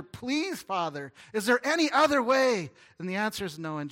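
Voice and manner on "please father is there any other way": begging. Retold or imitated. Jesus begging the Father.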